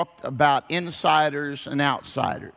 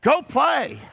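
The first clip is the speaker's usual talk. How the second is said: mom shooing out; 12 semitones higher than usual. Retold or imitated. Mom sending her son out to play, high shout. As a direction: shout the kid out the door. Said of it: "she would say".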